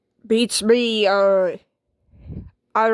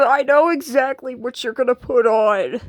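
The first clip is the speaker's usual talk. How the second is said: ordinary talk, nothing marked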